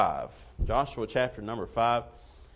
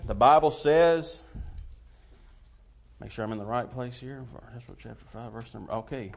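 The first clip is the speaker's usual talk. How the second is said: ordinary delivery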